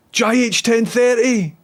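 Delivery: excited fan calling out a streamer's name; imitated shout. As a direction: call the name out across the park, drawn out. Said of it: "he shouted it".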